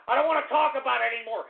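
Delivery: usual speaking voice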